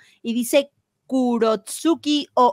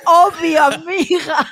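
loud laughing sassy obvio; 5 semitones higher, 5 dB louder, slower than usual. Pretend. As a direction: belt it like a sassy friend. Repeated louder, laughing.

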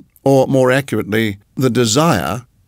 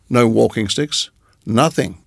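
ordinary delivery

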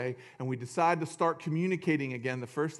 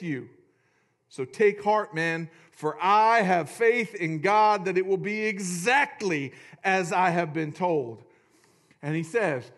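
dramatic scripture reading; 3 dB louder, slower than usual. Acted. Dramatic reading of Paul's words.

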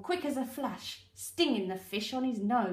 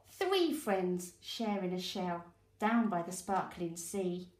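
ordinary delivery, nothing marked

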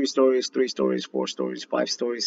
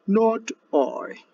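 high, lazy cat voice; cat refusing; 12 semitones higher than usual. Acted. Lazy cat refusing the hen, high purring voice. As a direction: purr the refusal lazily. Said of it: "purred the cat".

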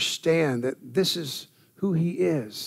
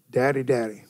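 ordinary talk, nothing marked

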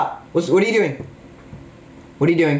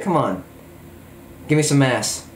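usual speaking voice

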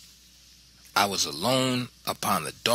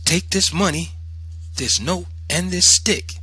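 loud, raised, item-by-item command; commanding mother; 9 semitones higher, 4 dB louder than usual. A narrator's reading. Mother arming son with money and stick, commanding. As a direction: issue each thing as an order.

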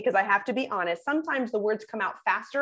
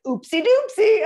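high, loud cutesy oopsie doopsie; sing-song cutesy oopsie; girlfriend's cutesy phrase; 11 semitones higher, 9 dB louder than usual. Retold or imitated. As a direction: chirp it like a cute girlfriend. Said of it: "like my girlfriend says".

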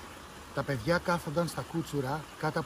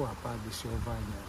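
ordinary delivery

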